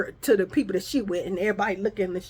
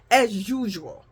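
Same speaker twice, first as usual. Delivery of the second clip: ordinary delivery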